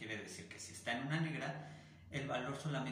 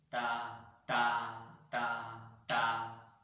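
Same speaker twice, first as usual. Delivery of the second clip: firm "ta" with louder hits on some beats; accented beats; 8 dB louder, much slower than usual. Shown as a demonstration. Teacher showing accents in a bar, firm punched "ta". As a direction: punch alternate "ta" beats with energy. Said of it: "los acentos en el tiempo dos y en el tiempo tres".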